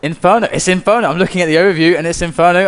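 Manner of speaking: hyped about the map reveal; excited. Real, not acted. Surprised at the map pick.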